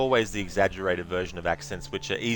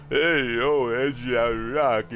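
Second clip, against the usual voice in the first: slow, slurred, overdone Stallone mumble, lifted a bit; exaggerated Stallone; 5 semitones higher, 3 dB louder, much slower than usual. Shown as a demonstration. Gamer showing the clichéd Stallone impression. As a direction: drag out "Rocky" in a hammed-up Stallone voice. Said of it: "A lot of people do the".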